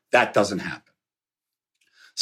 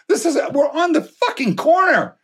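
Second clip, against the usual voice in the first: much higher, thrilled exclamation; retold amazement; 13 semitones higher than usual. Son amazed at the ringside seats, high-pitched excited exclamation. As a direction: act out being amazed at where you are.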